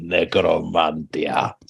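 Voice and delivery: "Necromantia" slowly with a voiced Greek "d" near the end; Greek pronunciation. Shown as a demonstration. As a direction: draw out "Necromantia" as Greeks pronounce it. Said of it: "spoken to pronounce it".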